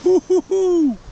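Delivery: high, loud woo-hoo; excited whoop. Real, not acted. Vlogger pausing for a drink, high excited whoop. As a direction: let out a big celebratory whoop. Celebrating a drink during the vlog.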